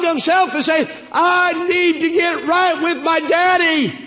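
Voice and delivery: loud, high, rising preacher's cry; preaching at full force. Acted. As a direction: build the sermon to a ringing climax.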